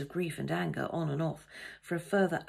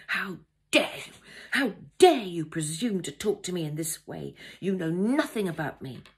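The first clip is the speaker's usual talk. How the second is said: louder outburst, stressing key words hard; explosive indignant anger; 7 dB louder than usual. A narrator's reading. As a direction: explode at a presumptuous stranger. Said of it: "my bomb exploded".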